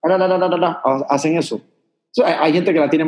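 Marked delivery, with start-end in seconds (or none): 0.0-0.6 rapid "la, la, la" tapped on the palate; "la" repeated as a tongue-tip demo. Shown as a demonstration. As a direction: keep the tongue stuck up high on each "la". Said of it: "tienen su lengua pegada al paladar".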